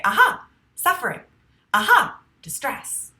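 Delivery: loud, high burst of discovery; waking-up aha. Shown as a demonstration. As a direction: demonstrate a sudden wake-up "aha". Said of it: "the sound that it makes is aha".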